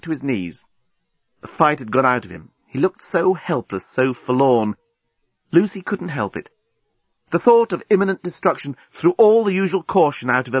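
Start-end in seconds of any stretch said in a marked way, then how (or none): none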